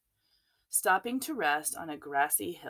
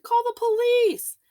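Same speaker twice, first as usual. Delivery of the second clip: very high, loud screaming alarm; panicked shout; 13 dB louder than usual. A narrator's reading. Frightened crowd calling for police, shouting. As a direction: bellow for help in panic. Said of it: "somebody shouted".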